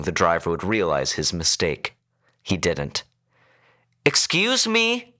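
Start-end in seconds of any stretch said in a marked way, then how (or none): 4.0-5.1 high, drawn-out call for attention; overloud. A narrator's reading. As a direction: call pointedly to the driver up front. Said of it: "she spoke overloud".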